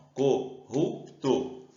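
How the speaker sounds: "Corrupto" chopped into three beats, cor, rup, to; syllable by syllable. Shown as a demonstration. Teacher splits the word by clapping trick, "Corrupto" in separate syllables. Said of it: "de bater palma".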